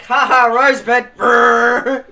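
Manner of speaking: loud, slow gleeful ha ha with engine brrr; gleeful mock laugh. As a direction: cackle while mowing. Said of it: "Me be like".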